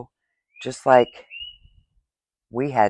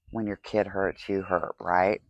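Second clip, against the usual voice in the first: ordinary delivery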